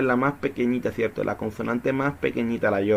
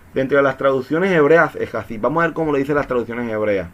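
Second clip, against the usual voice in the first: normal talking voice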